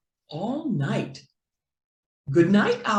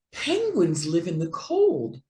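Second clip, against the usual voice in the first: usual speaking voice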